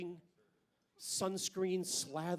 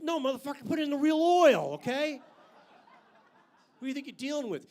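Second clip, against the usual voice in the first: loud, pitched-up blowup, real oil shouted; mock yell at mechanic; 6 semitones higher, 8 dB louder than usual. To the oil change guy, a loud mock tirade. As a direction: bark it at the service guy. Yelling at Jiffy Lube guy.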